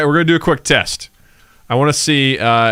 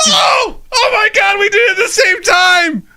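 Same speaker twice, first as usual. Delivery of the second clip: high excited yelling; excited shout; 4 dB louder than usual. Real, not acted. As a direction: shout it in sheer delight. Excited shout, same time.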